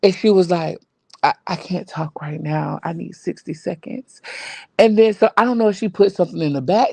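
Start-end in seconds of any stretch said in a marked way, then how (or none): 1.2-4.2 quiet, imitated choked-up voice; retold choked-up voice. Panelist imitating Ness asking for a moment, mildly choked up. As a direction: drop softer, as if imitating someone choked up. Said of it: "Ness got choked up".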